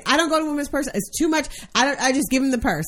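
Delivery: a light mocking echo of his mother's excuse; mocking imitation. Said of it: "word for word, says everything you always say".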